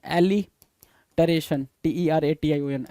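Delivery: "Alliteration" said very slowly, split after "एलि" with a long pause; broken into parts. Shown as a demonstration. Teacher spelling out a vocabulary word for students, "alliteration" slowly broken in two. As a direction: dictate "alliteration" in two slow chunks, "एलि", pause, "टरेशन".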